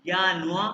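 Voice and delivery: यानुआ said "YAA-nu-aa", no final r; German यानुआ with open vowel ending; pronunciation model. Shown as a demonstration. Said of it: "मैं pronounce करूँ".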